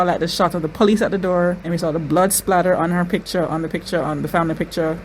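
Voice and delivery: ordinary talk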